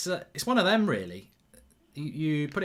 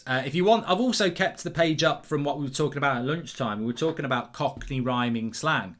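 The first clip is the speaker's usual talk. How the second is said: usual speaking voice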